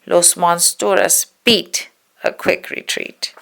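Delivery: ordinary delivery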